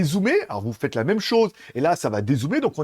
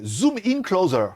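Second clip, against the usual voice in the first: French-accented English command; 3 semitones higher, slower than usual. Shown as a demonstration. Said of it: "mon accent est un petit peu à chier".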